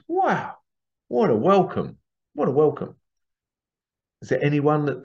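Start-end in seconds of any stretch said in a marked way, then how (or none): 0.1-3.0 much higher, amazed wow; lightly gushing surprise; delighted wonder. Acted. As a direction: marvel at a kind welcome. Marvelling at neighbour's gift.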